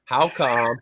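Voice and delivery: loud, hammed-up Wisconsin accent; exaggerated Midwestern Wisconsin accent. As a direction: put on a broad Wisconsin drawl. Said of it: "sounds like I'm from Wisconsin".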